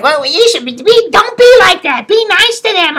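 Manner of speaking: very high-pitched mock pleading; mocked scolding woman. Woman telling patient to be nice, high mocking imitation. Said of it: "No, she was like".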